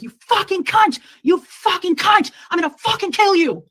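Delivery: high, loud, enraged scream. Retold or imitated. Woman re-enacting his threatening outburst at her, screaming. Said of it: "And he's screaming".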